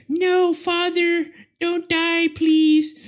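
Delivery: very high, slow, drawn-out plea; daughter's plea. Retold or imitated. Retold daughter begging father not to die, very high slow plea. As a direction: stretch it out like a melodramatic daughter. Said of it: "I'm making a different story".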